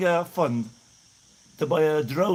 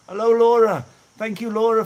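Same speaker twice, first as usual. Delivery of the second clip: excited greeting; 6 semitones higher, 8 dB louder than usual. Real, not acted. Greeting donor in live chat.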